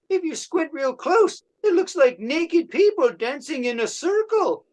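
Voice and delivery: a vain painter showing off his blobs; Henri Matisse. Acted. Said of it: "Here is my impression of Henri Matisse".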